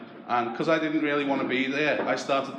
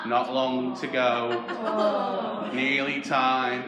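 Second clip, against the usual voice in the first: morbid mum; much slower than usual. Retold or imitated. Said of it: "walking around the house going".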